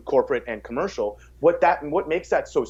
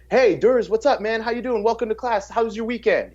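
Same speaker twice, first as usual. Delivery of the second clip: greeting raised high, friendly and welcoming; 8 semitones higher than usual. Shown as a demonstration. Coach welcoming a client who arrives early, friendly and raised high. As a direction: sound glad to see him walk in. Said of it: "That is saying".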